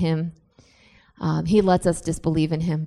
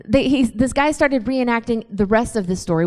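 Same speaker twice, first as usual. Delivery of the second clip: usual speaking voice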